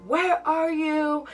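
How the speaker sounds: lightly voiced boyfriend demanding; ex on the phone.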